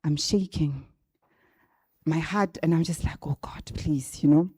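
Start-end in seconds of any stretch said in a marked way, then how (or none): 3.3-4.2 breathless whispered plea; desperate little prayer. Retold or imitated. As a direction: whisper it, begging God. Praying before the last attempt.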